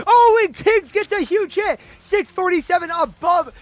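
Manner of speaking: hyped esports caster call; announcer voice. Real, not acted. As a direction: burst into a big play-by-play call. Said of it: "My announcer voice just went on there mid-sentence".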